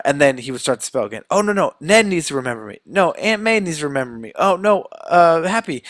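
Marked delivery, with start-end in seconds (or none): 1.3-5.8 high, hurried mock panic; frantic interrupting. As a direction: cut in urgently, acting flustered. Peter frantically interrupts the spell again.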